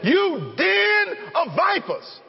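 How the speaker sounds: slow, high, drawn-out condemnation; bold rebuke. Acted. Preacher voicing John rebuking the Pharisees, slow and bold. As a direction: call them a den of vipers, slowly and boldly.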